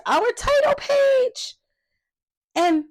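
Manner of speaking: put-on a kids' storyteller showing the title page; excited reveal. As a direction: unveil the title page with delight. Reading to children, excited reveal.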